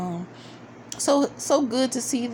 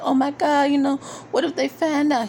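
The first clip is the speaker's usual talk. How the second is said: panicked; 6 semitones higher, 4 dB louder than usual. Retold or imitated. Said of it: "she's just like".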